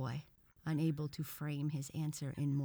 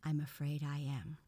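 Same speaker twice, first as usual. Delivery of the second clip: usual speaking voice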